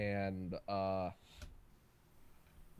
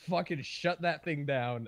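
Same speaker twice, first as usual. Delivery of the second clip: normal talking voice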